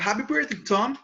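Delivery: bright, high birthday cheer; joyful greeting. Shown as a demonstration. Teacher wishing friend Tom happy birthday, joyful. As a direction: greet your friend with glee. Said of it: "When you wish someone a happy birthday".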